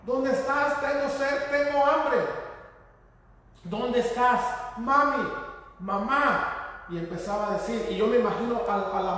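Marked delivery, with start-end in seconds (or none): 0.1-2.2 high-pitched, pleading call for mother; acted desperate calling, pitch held high; frightened child's cry. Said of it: "él gritaba y decía". 3.6-6.8 raised, pleading call for mommy; mildly acted searching cry; child calling out. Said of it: "él gritaba y decía".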